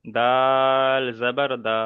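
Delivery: "দাল" held very long, then a long "দা"; drawn-out letters. Shown as a demonstration. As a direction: stretch "দাল" slowly as a correcting model. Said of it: "অক্ষরের টানগুলো খেয়াল করেন". ("দাল" আর "দা" লম্বা টেনে)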